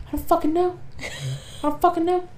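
high-pitched mock-clueless protest; helpless bewilderment. Husband answering what the fuck happened, helplessly bewildered.

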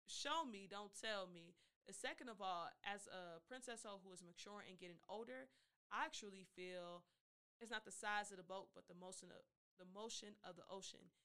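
ordinary talk

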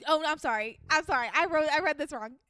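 normal talking voice

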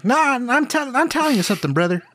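put-on East Texas accent. Dad telling his son something, East Texan accent. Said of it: "a East Texan one".

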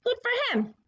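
"Good for him" jumped way up high in pitch, sing-song; mock high repeat. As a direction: repeat it high and half-mocking. Says good for him twice.

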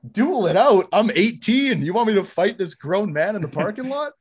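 high, loud, incredulous re-enactment; outraged disbelief. Retold or imitated. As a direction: throw the suggestion back in outrage. Outraged reply to coach, retold.

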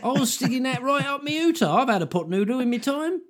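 high, hammy Cockney geezer; Cockney caught with the goods; Cockney accent. Retold or imitated. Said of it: "just me doing a Cockney accent".

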